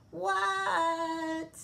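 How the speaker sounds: put-on high, loud squeaky kid reply; Froggy answering his mother; high child voice. A narrator's reading. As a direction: answer back like a small frog.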